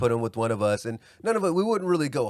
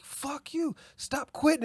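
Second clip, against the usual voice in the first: coach screaming; 14 semitones higher, slower than usual. Retold or imitated. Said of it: "they start screaming back and forth".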